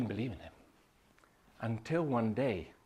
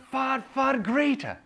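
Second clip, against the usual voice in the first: far, far greater, stretched slow and much higher; drawn-out emphasis; 12 semitones higher, 5 dB louder, slower than usual. Real, not acted. Preacher building to a climax, slow and soaring. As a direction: lift and stretch each far.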